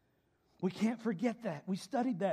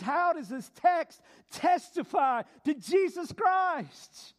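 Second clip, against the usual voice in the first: slow, loud, higher preached question; proclaimed question; 7 semitones higher, 5 dB louder, slower than usual. Real, not acted. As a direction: proclaim it to the hall. Restates the key question loudly.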